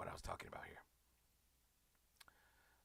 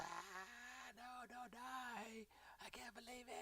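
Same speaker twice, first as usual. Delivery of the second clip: high, drawn-out put-on wail; hammed-up weeping in a high, soft voice; mock crying; 13 semitones higher, 9 dB quieter, much slower than usual. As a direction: imitate a sobbing moviegoer in falsetto. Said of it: "crying ... She's like".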